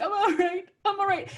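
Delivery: high-pitched, loud mock blubbering; retold crying. Crying fan answering the worried author, tearful. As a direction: insist through pretend tears, very high. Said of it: "I just started crying".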